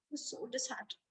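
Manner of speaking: hushed and sorrowful; soft sad aside. A narrator's reading. Storyteller feeling for Gerald, very soft. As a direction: say it softly, full of pity.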